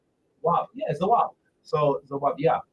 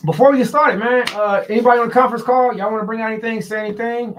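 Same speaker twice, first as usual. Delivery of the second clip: calling out; 8 semitones higher, 5 dB louder, faster than usual. Real, not acted. Calling out to conference call.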